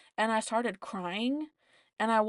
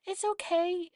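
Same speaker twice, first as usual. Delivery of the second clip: sympathetic, caring; 8 semitones higher, slower than usual. Retold or imitated. Recovery nurse comforting a crying patient, sympathetic and caring. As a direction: soothe a crying patient, pitched up high. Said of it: "so sympathetic and so caring".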